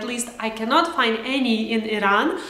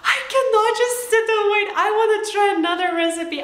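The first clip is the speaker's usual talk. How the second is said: playful inner voice; 8 semitones higher, faster than usual. Retold or imitated. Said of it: "I thought".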